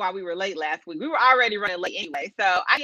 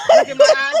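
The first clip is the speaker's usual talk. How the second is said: desperate plea; 10 dB louder than usual. Retold or imitated. Pleading to friend telepathically.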